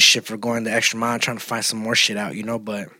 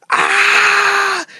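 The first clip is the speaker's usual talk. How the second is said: imitated scream; 7 dB louder than usual. Retelling kid's scream at pin pull.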